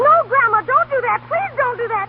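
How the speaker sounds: very high, desperate, near-hysterical pleading; frantic begging. Acted. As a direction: beg for your life, voice cracking high. Girl begs not to go back.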